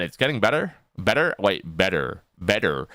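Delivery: "better" with the T softened to a quick D; American soft T. Shown as a demonstration. Mocking how you guys say it, American-accented "better" with no crisp T. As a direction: say "better" American-style, tapped middle and sounded R. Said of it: "you guys say better 'cause you guys can't pronounce your T's".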